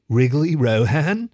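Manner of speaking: slow, loud mocking drawl; teasing. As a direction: taunt him with his nickname. Girl teasing the newcomer.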